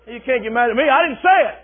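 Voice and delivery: normal talking voice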